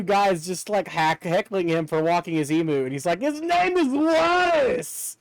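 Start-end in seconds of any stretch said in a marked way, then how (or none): 3.2-5.2 put-on high, drawn-out heckled bloke with a British accent; mock British accent, pitched well up; imitated British accent. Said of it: "the way the guy's talking, like, the accent".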